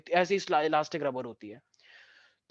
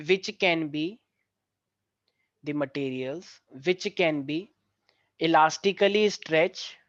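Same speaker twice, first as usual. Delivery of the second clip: each phrase spoken very slowly for students to copy; slow, clear classroom dictation; much slower than usual. Shown as a demonstration. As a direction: dictate word by word with long pauses. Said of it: "लिखो सारे बच्चे".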